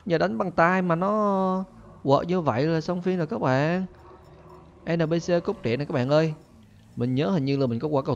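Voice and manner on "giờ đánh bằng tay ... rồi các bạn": normal talking voice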